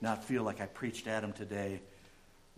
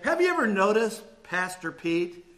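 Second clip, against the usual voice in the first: loud and high, nearly shouting, the end slowed down; loud old lady; 7 semitones higher, 14 dB louder than usual. Retold or imitated. Elderly lady mocking the long-winded pastor aloud, loud and high. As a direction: blurt it across the room on purpose. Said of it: "talking at this tone of voice".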